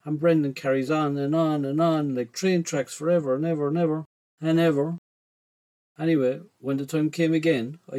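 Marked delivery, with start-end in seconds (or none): none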